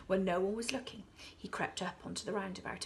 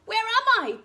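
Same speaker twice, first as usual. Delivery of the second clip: very high, loud, flustered cries; tumbling crocodile crying for help; dazed crocodile panic; 12 dB louder, much slower than usual. A narrator's reading. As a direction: squeal in confusion. Crocodile knocked over, dazed.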